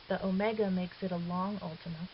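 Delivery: ordinary talk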